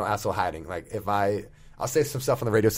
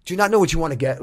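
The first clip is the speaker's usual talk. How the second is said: snapping at a customer; 7 semitones higher, 6 dB louder than usual. Retold or imitated. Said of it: "was kinda snapping at me".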